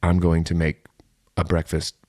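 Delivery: ordinary talk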